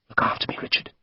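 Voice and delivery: hushed, voiceless plea; whisper. A narrator's reading. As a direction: breathe it so nobody else hears. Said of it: "she murmured".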